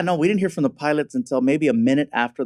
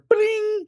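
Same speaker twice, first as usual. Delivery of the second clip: notification chime. Retold or imitated. Imitating the phone notification sound.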